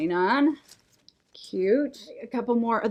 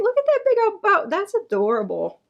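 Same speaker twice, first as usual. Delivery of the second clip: high, louder thrilled cry; excited squeal; 9 semitones higher, 4 dB louder than usual. Real, not acted. Host spotting a giant bow, high excited squeal. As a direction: let the delight burst out.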